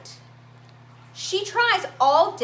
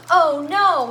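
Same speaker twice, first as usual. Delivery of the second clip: alarmed; 2 semitones higher, 8 dB louder than usual. A narrator's reading. Narrator warns of coming accident.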